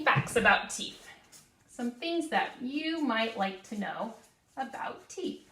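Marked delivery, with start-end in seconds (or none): 4.6-5.5 high breathy whisper of a secret; teasing whisper. Shown as a demonstration. Teacher teasing first graders, a high secret whisper.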